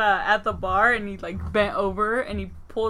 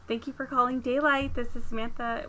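phone voice; 7 dB quieter than usual. Shown as a demonstration.

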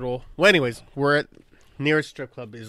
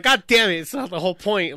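loud, high, exasperated; frustrated outburst; 5 semitones higher, 8 dB louder than usual. Retold or imitated. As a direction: blurt it out, fed up. Retold frustration at no alcohol.

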